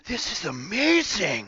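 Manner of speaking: high, drawn-out gushing exclamation; Evan. Retold or imitated. Player mimicking an absent friend for the group, high drawn-out impression. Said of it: "That was my best Evan impression".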